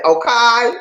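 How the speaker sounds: OKAY pitched high and punched, second syllable drawn to "kye"; attention-getting okay. Shown as a demonstration. Host grabbing viewers' attention, high punched okay. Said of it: "sometimes I'll say".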